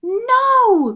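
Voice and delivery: high, loud desperate cry; cried out. A narrator's reading. Marie crying out to stop the Mouse King, loud. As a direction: scream it to save him. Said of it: "cried Marie".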